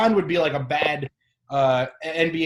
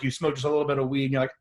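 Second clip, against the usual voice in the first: ordinary delivery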